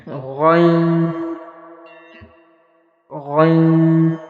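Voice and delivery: Ghain gargled from the middle of the throat; heavy Ghain. Shown as a demonstration. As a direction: Ghain held long and emphatic. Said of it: "with emphasis".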